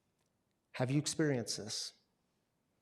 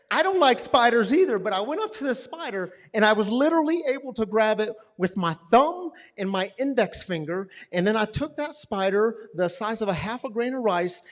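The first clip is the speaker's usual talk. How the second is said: preaching story; 7 semitones higher, 11 dB louder than usual. Acted.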